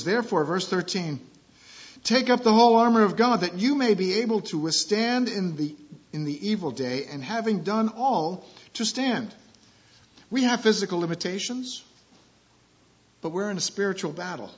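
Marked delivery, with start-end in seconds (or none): 2.0-9.3 louder, raised pitch reading of scripture. Acted. Pastor reading verse to congregation, proclaiming and raised. Reads scripture aloud in sermon.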